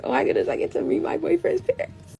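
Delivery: high-pitched excited gush, voice climbing; freaking out. Retold or imitated. Girlfriend about to meet boyfriend's parents, freaking out. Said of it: "I'm kinda freaking out".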